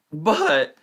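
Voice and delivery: sudden high squeak. Real, not acted. Fantasy football streamer's voice squeaking mid-sentence, high squeak. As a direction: voice cracks up into a squeak. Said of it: "there's my daily squeak".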